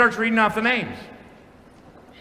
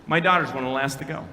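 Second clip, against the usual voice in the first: ordinary talk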